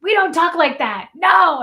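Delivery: loud, high stern scolding; dad's firm no. Retold or imitated. Dad stopping his kids' negative talk, loud stern no. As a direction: shut it down like a strict dad. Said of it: "he was like".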